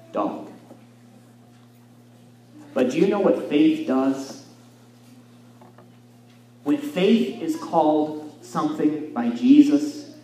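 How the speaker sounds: ordinary delivery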